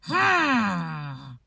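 low, soft doubtful hum; skeptical hmm. Retold or imitated. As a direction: hum your suspicion at the cashier. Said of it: "looking at her like".

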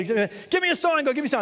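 high-pitched pleading shout; crying out to God. Retold or imitated. Preacher acting movie Bruce asking for a sign, crying out. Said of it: "he's crying out to God".

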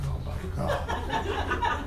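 low, slow, breathy mock dismay; a laughing imitation of an embarrassed wife; wife reacting to his outburst, mock dismay; wife's mortified groan. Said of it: "my wife was like".

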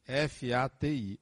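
F, A, T, I said letter by letter, slowly; one unhurried letter at a time: F A T I; spelled out. Shown as a demonstration. Spells the term just named.

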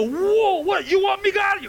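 high, loud mock astonishment; startled believer. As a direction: shout it like someone suddenly caught by God. Startled believer answering God, acted.

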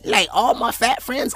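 usual speaking voice

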